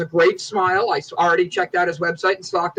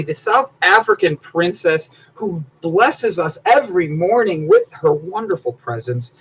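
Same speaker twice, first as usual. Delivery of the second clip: ordinary delivery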